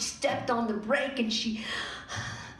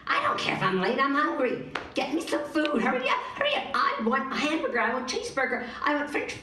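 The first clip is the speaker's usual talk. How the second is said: impatient demands; 5 semitones higher, faster than usual. Acted. Stacy demanding food impatiently.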